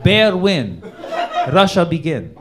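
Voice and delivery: loud, slow mock Russian-accented narration, laughing; loud broken-English Russian narrator, laughing; mock Putin screenplay. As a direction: read a screenplay as a hammy Russian. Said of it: "He's like".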